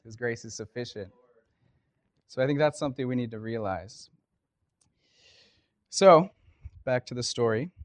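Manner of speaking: usual speaking voice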